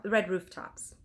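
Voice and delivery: usual speaking voice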